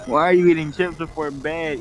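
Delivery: ordinary delivery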